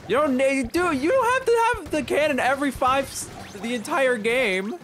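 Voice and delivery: high, loud complaint; exasperated protest. Real, not acted. Player objecting to teammate taking cannon, exasperated.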